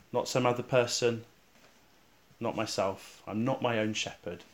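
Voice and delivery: normal talking voice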